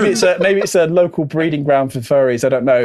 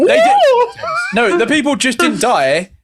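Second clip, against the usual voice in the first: laughing through the words; giggling while talking; 9 semitones higher than usual. Real, not acted.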